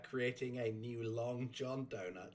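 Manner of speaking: normal talking voice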